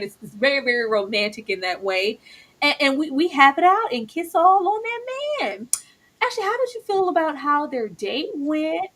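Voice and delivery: normal talking voice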